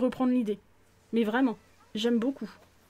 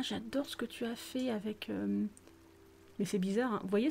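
normal talking voice

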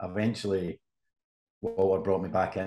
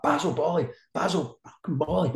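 high-pitched retold fury at a reporter; hammed-up furious manager; imitated roar; 8 semitones higher than usual. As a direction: spit the name out like a raging boss. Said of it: "he's roaring at me".

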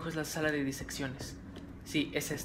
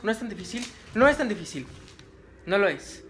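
higher, louder pep talk; excited self-encouragement; 5 semitones higher, 6 dB louder than usual. Real, not acted. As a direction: psych yourself up. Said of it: "coaching himself in fight". (dándose ánimos)

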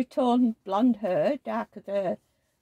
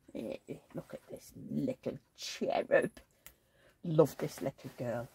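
tender; 6 semitones lower, 9 dB quieter than usual. Real, not acted. Admiring the doll.

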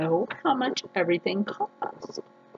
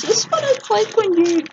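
excited; 11 semitones higher, 4 dB louder than usual. Real, not acted. Excited unboxing.

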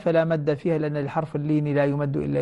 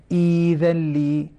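إيذا لي chanted slowly, the long ي held two beats; recited tajweed; much slower than usual. Shown as a demonstration. Teacher reciting إيذا لي slowly as a verse example. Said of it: "هذه مدود بدل".

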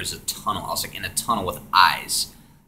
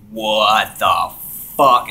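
startled outburst; 8 dB louder, much slower than usual. Retold or imitated. Freaking out in the trip.

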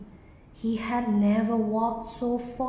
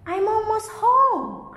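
much higher, louder, thrilled; excited cry; 11 semitones higher, 6 dB louder than usual. A narrator's reading. Bee hears the stream near home, excited cry. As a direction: burst out in joy at the stream. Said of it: "cried the greedy bee excitedly".

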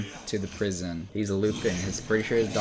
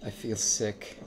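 ordinary talk, nothing marked

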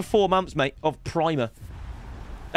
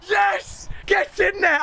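excited shout; 12 semitones higher, much slower than usual. Real, not acted. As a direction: punch the air and shout it. Celebrating pack.

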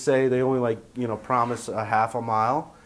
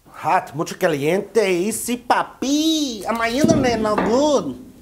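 hammed-up Mexican accent, much higher and louder; mock Mexican accent; 9 semitones higher, 4 dB louder than usual. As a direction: ham up a Mexican accent with slow, stretched words. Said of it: "There's the Mexican".

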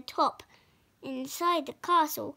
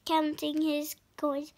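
ordinary delivery

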